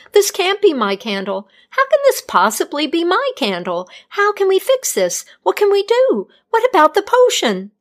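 building from plain to high frantic questions; panicked healer. A narrator's reading. Healer seeing her burned-down candle, building panic. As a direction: denial turning to panic.

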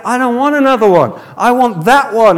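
put-on high, loud little-girl protest; mock upset child. Little girl refusing Daddy's offer of a new doll, mock upset. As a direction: imitate a tearful child insisting, stretching the end. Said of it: "this little girl starts crying".

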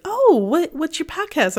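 high, quick eager questions; chirpy vendor's eager follow-up; eager interest. Retold or imitated. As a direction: lean in, keen to know. Vendor eagerly asks about podcast.

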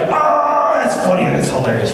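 hammed-up appreciation of a joke; mock delight. Translators hearing the horse euphemism, mock delight.

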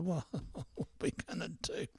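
ordinary talk

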